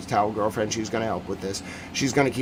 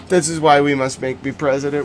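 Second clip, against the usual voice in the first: normal talking voice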